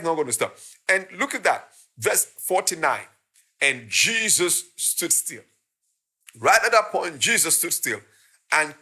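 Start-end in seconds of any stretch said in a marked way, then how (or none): 3.6-5.4 very slow, weighty reading; dramatic pause. Acted. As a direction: let the moment hang, slowly.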